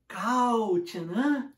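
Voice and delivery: "Cautionan" drawn out very slowly, spelled-out Portuguese reading; Portuguese-style pronunciation. Retold or imitated. Student trying a misread English word on a gym classmate, slow. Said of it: "com a pronúncia, seguindo o padrão linguístico do português".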